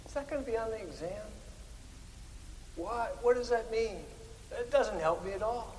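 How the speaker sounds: softly mimicked pupil's question; quoted student.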